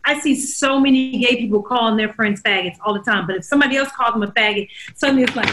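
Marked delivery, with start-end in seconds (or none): none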